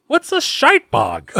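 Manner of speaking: slow, high-pitched puzzled voice; hammed-up high, drawn-out confusion; baffled victim. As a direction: stretch it out in comic bewilderment. Plays victim confused by the brogue.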